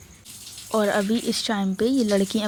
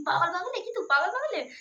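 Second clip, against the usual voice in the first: retold scolding; 8 semitones higher than usual. Retold mother scolding her.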